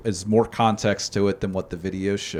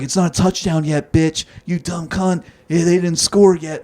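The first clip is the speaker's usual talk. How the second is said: higher-pitched mock heckling; imitated angry yelling; 8 semitones higher, 4 dB louder than usual. Older Eagles fan heckling a Packers fan, imitated yelling. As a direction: imitate an irate fan's jeering. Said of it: "he's just yelling at them from the row behind them".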